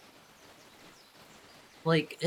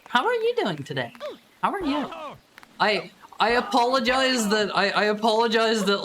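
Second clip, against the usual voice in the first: ordinary delivery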